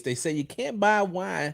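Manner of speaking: ordinary delivery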